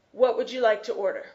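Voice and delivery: rattled off fast like a drive-through clerk. Shown as a demonstration. Drive-through worker rattling off the order question fast. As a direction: rush it out in one quick breath. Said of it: "they'll say this very fast".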